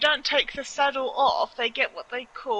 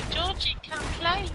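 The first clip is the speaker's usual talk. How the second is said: creepy clown lure; 7 semitones higher, 3 dB quieter than usual. Retold or imitated. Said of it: "So when you go".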